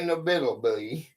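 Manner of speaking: fast, low rehearsal of "inevitability" under the breath; a rushed practice run at the hard word; quick muttered try. Real, not acted. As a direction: rattle "inevitability" off quickly to yourself. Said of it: "I can't even say it".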